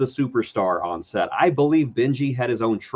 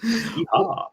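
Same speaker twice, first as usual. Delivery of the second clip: high Texas cowboy whoop; playful mock-Texan yeehaw; Texas accent; 10 semitones higher than usual. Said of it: "my Texas accent from now on".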